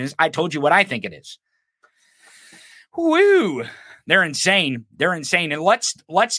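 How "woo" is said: excited whoop. Real, not acted. Exclaims after rant.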